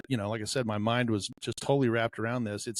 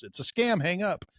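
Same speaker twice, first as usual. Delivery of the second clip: urgent warning; 6 semitones higher than usual. Retold or imitated. Officer's urgent warning retold.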